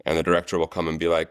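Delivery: ordinary talk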